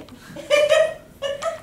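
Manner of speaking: high, loud delighted giggle; giggling laugh. Acted. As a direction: burst into a gleeful giggle. Pokaka laughs with joy.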